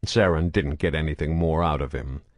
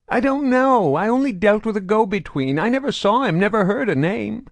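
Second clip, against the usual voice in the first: high, loud frantic voice; frightened pleading; 5 dB louder than usual. A narrator's reading. Prisoner pleads with interrogator, high and frantic. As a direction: insist desperately you know nothing.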